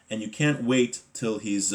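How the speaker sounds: ordinary talk, nothing marked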